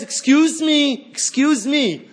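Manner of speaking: polite inner critic. Retold or imitated. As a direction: play the sweetly insistent critic whispering in your ear. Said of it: "that piece of Gehenna says".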